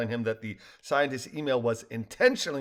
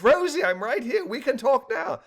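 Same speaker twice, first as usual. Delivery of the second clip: high, eager and excited; enthused; 12 semitones higher, 4 dB louder than usual. A narrator's reading. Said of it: "Balloony enthused".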